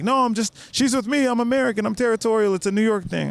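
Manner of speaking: high-pitched defensive excuse; retold flustered protest. Shielding the girl from a pass, flustered and high. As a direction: pitch it high as if hurriedly staking a claim.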